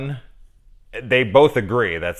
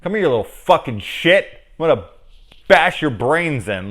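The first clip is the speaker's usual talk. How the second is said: louder, higher hammed-up menace; menacing threat; 7 semitones higher, 7 dB louder than usual. Shown as a demonstration. Showing how the villain should threaten, mock fury. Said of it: "there's a huge difference".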